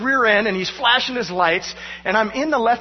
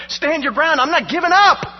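high, louder defiant declaration; defiant resolve; 7 semitones higher, 4 dB louder than usual. Pretend. Pastor voicing resolve against the devil, defiant and loud. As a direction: refuse to give an inch. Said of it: "images are very important".

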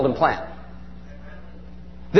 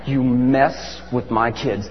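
low, soft warning, slowing at the threat; quiet menace; 10 semitones lower, 9 dB quieter than usual. Real, not acted. Parent warning off whoever touches the kids, quietly menacing.

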